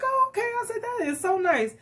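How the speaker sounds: high, soft pleased surprise; answering the friend with high pleased surprise. Retold or imitated. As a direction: sound delighted and a little caught off guard.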